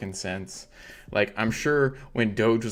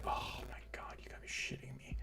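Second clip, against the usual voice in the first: breathless whisper of disbelief; 18 dB quieter than usual. Retold or imitated. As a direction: whisper it like you can't believe it. Disbelief at merchant's complaint.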